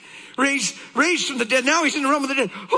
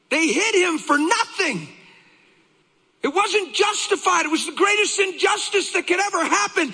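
high, insistent pulpit voice; fervent, high-pitched sermon; impassioned preaching; 5 semitones higher than usual. Real, not acted. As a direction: preach it with rising urgency.

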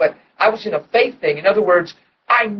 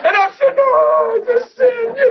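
laughing. Retold or imitated. Retelling answer to wife, laughing.

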